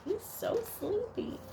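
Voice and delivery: high and hushed; soft high sleepy aside. A narrator's reading. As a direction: whisper it fondly to a child. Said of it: "aside to child".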